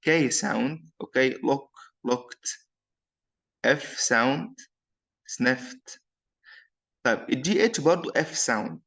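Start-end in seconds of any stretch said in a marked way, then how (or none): 2.1-2.7 "looked" said as one syllable, "lookt"; final ED as T. Shown as a demonstration. Teacher showing ED after K sound, "looked" with T ending. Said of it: "أنطق ال E D like T sound". 5.3-6.0 "sniffed" said as "snift"; final ED as T. Shown as a demonstration. Teacher showing ED after F sound, "sniffed" with T ending. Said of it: "أنطق ال E D like T sound".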